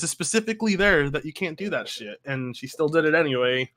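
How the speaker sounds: ordinary delivery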